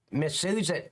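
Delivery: normal talking voice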